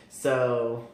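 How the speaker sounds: ordinary delivery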